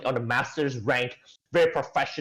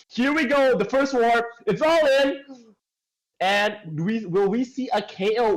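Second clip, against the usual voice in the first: excited play-by-play; 8 semitones higher than usual. Acted. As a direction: call the teamfight as it breaks out. Hyped play-by-play of fight.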